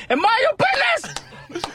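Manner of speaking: high, slow, drawn-out proclamation; hammed-up outburst with a laugh in it; mock-indignant cry. Telling everyone to stay in their lane, mock indignant. Comic rant about staying in lanes.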